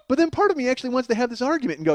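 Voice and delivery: usual speaking voice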